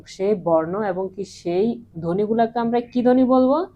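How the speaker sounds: ordinary delivery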